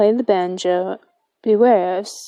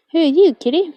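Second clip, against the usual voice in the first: pet talk; 6 semitones higher, 4 dB louder, much faster than usual. Real, not acted. Talking to a cat in game.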